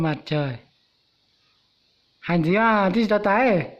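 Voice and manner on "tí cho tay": ordinary talk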